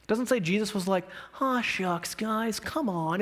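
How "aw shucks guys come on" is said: mild, drawn-out aw-shucks plea; mock mild protest. Preacher mimics a Jesus who isn't angry, mild and folksy. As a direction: gently chide them, slowly, as an act. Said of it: "It says Jesus was furious".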